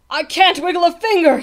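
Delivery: high, loud lament; wailing. A narrator's reading. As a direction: wail in helpless distress. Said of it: "the trumpeter wailed".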